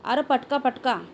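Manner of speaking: mild, urgent call of alarm; yelled warning. A narrator's reading. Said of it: "कोणतरी ओरडलं".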